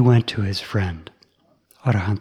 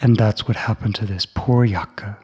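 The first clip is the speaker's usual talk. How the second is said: usual speaking voice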